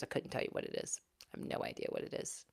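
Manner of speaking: whispered confession. Real, not acted. Mom admits to viewers she knows nothing, whispered. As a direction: whisper it like a little secret.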